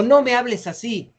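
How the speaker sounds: high-pitched mock rebuke; exclaimed rebuke. Shown as a demonstration. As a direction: demonstrate an indignant exclamation. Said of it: "Todos signos de, de admiración".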